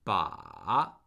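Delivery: Ba dipping very low, then rising; third tone on Ba. Shown as a demonstration. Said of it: "that's the third tone".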